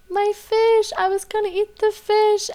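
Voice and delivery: high-pitched mock child wail; imitating crying kids. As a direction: whine like a heartbroken little kid. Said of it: "The kids are still crying".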